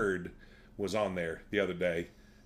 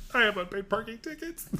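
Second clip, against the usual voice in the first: sheepish admission; 12 semitones higher than usual. Retold or imitated.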